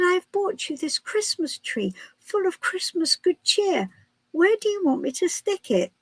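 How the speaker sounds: high, sweet little angel voice; cheery angel. Acted. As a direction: play an innocent angel bearing a gift. Angel's cheery line in joke.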